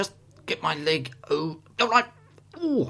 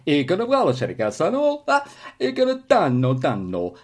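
loud, hammy mock Italian gibberish; fake Italian; 2 semitones higher, 4 dB louder than usual. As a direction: go big with pretend Italian. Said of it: "Now we can speak Italian".

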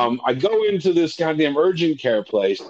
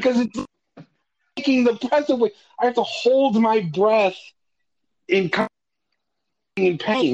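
high-pitched, exasperated protest; flustered; 9 semitones higher than usual. Retold or imitated. Patient repeating himself about his breath, flustered. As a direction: explain it with rising exasperation, voice pitched way up. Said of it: "getting a little more flustered".

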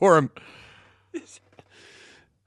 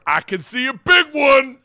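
loud, higher hyped call of a big dunk; mock announcer shout; 8 semitones higher, 5 dB louder than usual. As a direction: belt it like the excited announcer. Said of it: "I love the warning for it".

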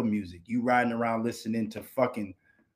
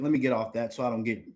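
usual speaking voice

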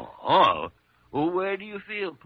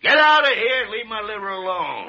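loud, higher bellow after him; angry shout; 7 semitones higher, 8 dB louder than usual. Acted. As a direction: yell him out the door. Doctor shouting after Jimmy.

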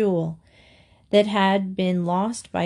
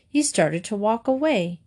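plain reading voice

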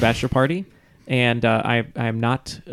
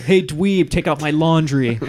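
put-on teen movie bully; 4 semitones higher, 3 dB louder, slower than usual.

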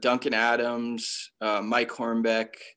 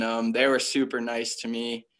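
ordinary delivery

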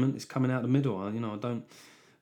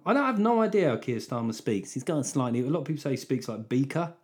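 normal talking voice

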